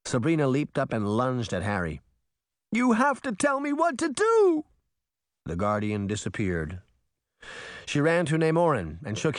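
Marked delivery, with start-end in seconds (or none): 2.7-4.6 high, loud frantic cry; desperate shout. A narrator's reading. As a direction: demand it in desperation. Sabrina lunges at mirror.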